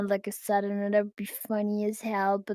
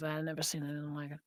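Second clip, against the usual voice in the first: Boomhauer voice; 8 semitones lower, 16 dB quieter, much faster than usual. Retold or imitated. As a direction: rattle it off low and quick like Boomhauer. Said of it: "Boomhauer is like".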